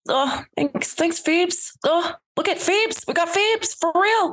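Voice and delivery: high, bright Rachel-style voice; Jennifer Aniston impression. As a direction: do your best Jen Aniston. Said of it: "Her Jen Ann impression".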